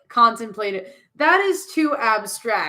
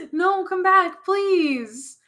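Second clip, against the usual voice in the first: high, drawn-out plea; pleading; 9 semitones higher, much slower than usual. Acted. Friend begging Clearwing to come back, pleading. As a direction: call after them, imploring. Calling after fleeing character.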